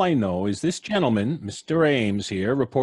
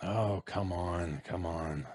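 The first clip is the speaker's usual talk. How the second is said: much softer, slow grumble; impatient mutter; 7 semitones lower, 12 dB quieter, slower than usual. Acted. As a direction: grumble under the breath. Cop mutters impatiently at door.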